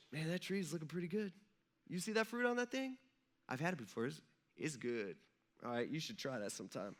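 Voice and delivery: very soft, offhand mock tempter; sly coaxing. Pretend God tempting Eve with the fruit, softly coaxing. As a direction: casually nudge someone toward mischief, hushed.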